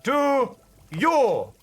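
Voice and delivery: silly put-on voice. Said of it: "voice play with repeated line".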